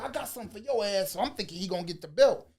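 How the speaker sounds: ordinary delivery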